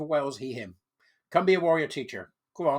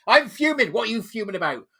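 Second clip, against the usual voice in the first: high, loud, laughing put-on fuming voice; mock fuming; 7 semitones higher, 7 dB louder than usual. The vlogger voicing a fuming activist, laughing as he goes. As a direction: camp it up through a laugh. Acted dialogue between two voices.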